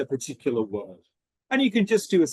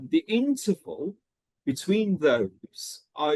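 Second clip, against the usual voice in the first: normal talking voice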